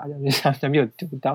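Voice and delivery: normal talking voice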